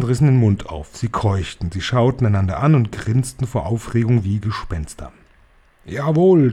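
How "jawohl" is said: higher, louder thundering cry; higher, loud thundering yes. Acted. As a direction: boom it out over the crowd. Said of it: "donnerte die Hoch- und Großmeisterhexe".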